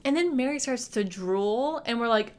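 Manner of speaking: normal talking voice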